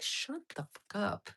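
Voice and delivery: soft, slow mock scolding; mock hushed scold. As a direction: hush them down, half joking. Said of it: "She's like".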